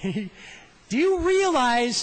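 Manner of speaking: very slow, high, loud mock of Kermit; Kermit's loud voice. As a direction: stretch it out booming like Kermit. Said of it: "he's real loud, too".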